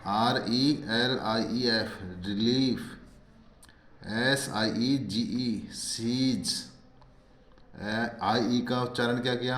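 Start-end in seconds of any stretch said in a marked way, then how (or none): 2.2-2.8 "रिलीफ" with a long ई in the last syllable; teacher modelling "रिलीफ" with long ee; long ee demonstration. Shown as a demonstration. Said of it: "बड़ी ई की मात्रा". 5.7-6.5 "सीज" drawn out with a long ई; teacher stretching the vowel of "सीज"; long ee demonstration. Shown as a demonstration. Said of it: "बड़ी ई की मात्रा".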